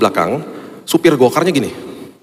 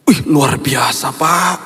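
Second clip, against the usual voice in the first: amazed exclamation; 3 semitones higher, much slower than usual. Retold or imitated. Driver amazed recognizing pastor.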